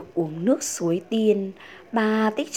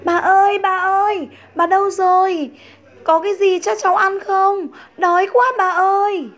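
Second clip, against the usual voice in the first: put-on much higher and louder, a hungry child shouting for grandma; child calling out; 11 semitones higher, 8 dB louder, faster than usual. A narrator's reading. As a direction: call across the house, whiny and hungry. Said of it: "gọi bà".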